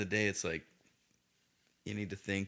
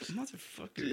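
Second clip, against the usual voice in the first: bitter mutter; 13 semitones higher, 7 dB quieter than usual. Retold or imitated. Regretful curse, retold.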